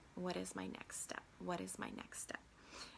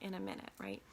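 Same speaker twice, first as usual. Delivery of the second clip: ordinary talk, nothing marked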